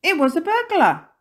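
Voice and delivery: high, loud storyteller exclamation; spring the surprise on the listeners; dramatic reveal. A narrator's reading. Narrator revealing a burglar at the window, high and dramatic.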